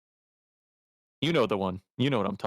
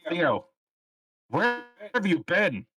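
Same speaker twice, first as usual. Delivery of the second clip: in-character call; 7 semitones higher, slower than usual. Acted. Character greets Theo in-game.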